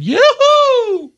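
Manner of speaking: put-on high, loud child's yoo-hoo; excited yoo-hoo. Little boy calling out to Santa, high and loud. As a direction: squeal it out like a thrilled little kid.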